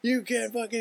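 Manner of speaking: high-pitched mock rage; hammed-up outburst at the driver; super mad. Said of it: "he gets super mad".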